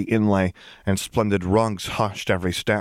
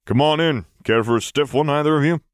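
higher, louder welcoming host; hearty Solo; 7 semitones higher, 3 dB louder than usual. A narrator's reading. Solo welcoming guests to a stiff drink, hearty and louder. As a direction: throw the door open with a grin.

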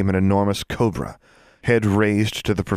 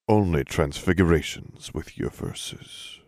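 slow, lower chanted verse; low rhythmic cadence; 3 semitones lower, 4 dB quieter, slower than usual. A narrator's reading. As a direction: intone it like an incantation. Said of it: "spoke in a low, rhythmic cadence".

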